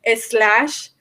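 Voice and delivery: "slash" said as "eslash" with a leading e; Spanish pronunciation. Shown as a demonstration. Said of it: "you have to put an E at the beginning".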